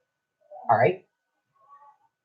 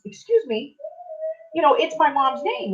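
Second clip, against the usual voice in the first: high-pitched imitation of indignant objection; protesting; 11 semitones higher than usual. Daughter objecting to the hat woman, imitated indignant protest. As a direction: mimic an affronted customer, pitched well up.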